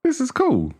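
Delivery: high, light "oh, this is cool"; lifted way up in casual delight; pleasantly surprised. Retold or imitated. Recalling the film's lighthearted opening, high surprised delight. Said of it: "I was like".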